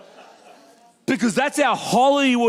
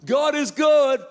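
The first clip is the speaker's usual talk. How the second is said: a hammed-up cheery church slogan, slow; mock cheerful slogan; 4 semitones higher, slower than usual. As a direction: parrot the shallow optimism. Shallow optimism mock slogan.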